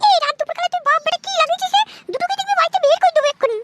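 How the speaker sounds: very high-pitched cartoon mother, irritated; high put-on mother voice; scolding mother. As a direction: scold the boy in a squeaky, high mum voice. Skit mother threatens father and son.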